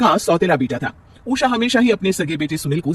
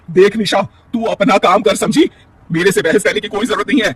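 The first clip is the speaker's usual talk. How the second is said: stern scolding; 4 semitones higher, 5 dB louder than usual. Acted. Mother-in-law scolds daughter-in-law.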